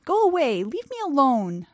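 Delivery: put-on scared child. A narrator's reading. Scared boy's voice.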